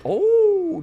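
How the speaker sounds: very high, loud "oh"; sudden high-pitched cry; startled yelp. Real, not acted. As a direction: yelp mid-sentence as the game startles you. Game event interrupts his story.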